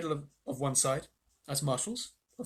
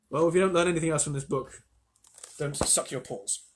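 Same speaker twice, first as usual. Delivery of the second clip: ordinary delivery, nothing marked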